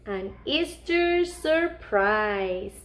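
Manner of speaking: ordinary delivery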